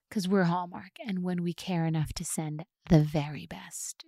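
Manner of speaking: soft, low, hushed and unhurried; a whispery, drawn-out calm customer-service voice; super calm. Retold or imitated. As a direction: murmur it like nothing is urgent. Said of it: "Someone remains super calm".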